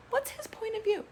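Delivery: mock the excuse with a sneer; sarcastic scoff. Real, not acted. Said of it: "That he just wanted to fuck her?".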